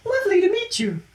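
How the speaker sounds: very high, airy, gentle alien voice; Mantis voice. Acted. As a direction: float it up high, sweet and timid.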